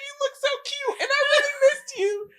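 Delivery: high, loud, sobbing-laughing gush; gushing through happy tears at her friend; laugh-crying. Retold or imitated. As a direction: blubber it out between laughs. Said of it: "I literally started crying".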